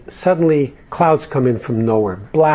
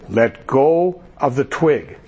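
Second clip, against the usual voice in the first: voice of God; slower than usual. Acted. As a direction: speak it slowly like God from the sky. God answers man in joke.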